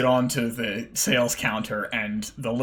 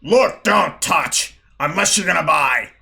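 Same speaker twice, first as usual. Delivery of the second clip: growling; 5 semitones higher, 7 dB louder than usual. Acted. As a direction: growl a warning at the customer. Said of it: "Bildrith growls to you".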